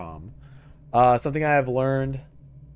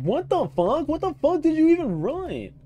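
much higher, half-shrieked disbelief; shocked outburst; 5 dB louder than usual. Retold or imitated. Reacting to a garbled translation with a high shocked squeal. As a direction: squeal it like you can't believe what you're reading. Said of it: "that's why I said".

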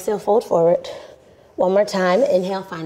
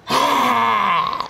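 lion's breath exhale; 3 semitones higher than usual. Shown as a demonstration. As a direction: breathe out hard like a lion, tongue out. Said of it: "Lion's breath".

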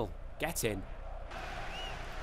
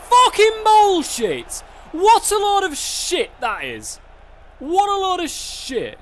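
much higher and louder, slow furious rant; angry outburst; 7 semitones higher, 8 dB louder, slower than usual. Real, not acted. Gamer raging at the game after a bad call, real furious shouting. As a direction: spit out the disbelief.

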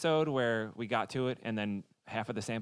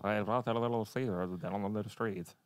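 gargled synthesized speech voice; computer voice; 4 semitones lower, 4 dB quieter than usual. Retold or imitated. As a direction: imitate a speech computer, gurgly. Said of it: "you sound like a gargling fish".

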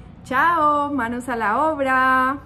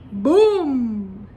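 loud boom; 6 dB louder than usual. Shown as a demonstration. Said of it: "Encontraste de pronto". (boom fuerte)

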